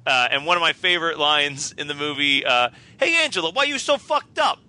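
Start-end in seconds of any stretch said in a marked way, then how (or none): 3.0-4.6 high-pitched mocking jeer; taunting bully. Bully picking on Angela, high mocking taunt. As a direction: jeer at her like a camp bully. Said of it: "the bullies that are picking on".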